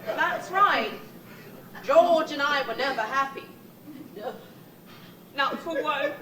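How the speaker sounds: normal talking voice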